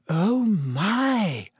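high, drawn-out exclamation of shock; shocked dismay. A narrator's reading. Hermione sees the slashed portrait.

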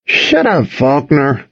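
high-pitched grumpy retort; grumbling. A narrator's reading. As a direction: grumble back at the teasing. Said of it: "Austin grumbled".

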